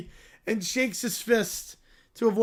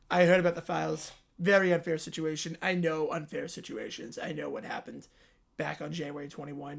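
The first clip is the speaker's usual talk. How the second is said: low, soft Trump voice; Trump impression; 7 semitones lower, 8 dB quieter than usual. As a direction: mumble it like Trump rambling to the crowd. Trump voice again.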